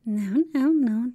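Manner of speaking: loud burst of laughter through the words; laughing no. Acted. Villain cracking up at the hero's mock confession, laughing burst. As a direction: laugh it off, amused by her own tease. Laughs at her own joke.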